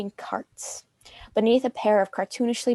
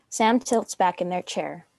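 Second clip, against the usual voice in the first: ordinary delivery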